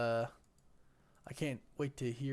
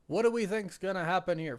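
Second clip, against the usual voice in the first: excited question; 6 semitones higher, 8 dB louder than usual. Real, not acted. Hyped stream question.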